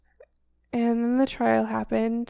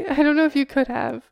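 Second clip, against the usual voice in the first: laughing; 3 semitones higher, 6 dB louder, faster than usual. Real, not acted. Both laughing.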